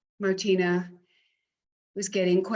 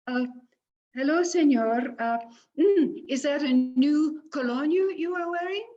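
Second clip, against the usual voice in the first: a lightly voiced shy young woman, a bit higher; coy young Martina; 6 semitones higher than usual.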